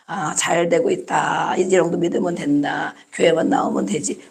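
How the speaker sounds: low, soft, complacent murmur; untroubled churchgoer. Acted. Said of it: "문제가 없어. 그냥". (느긋하고 안일하게 낮춰 말하기)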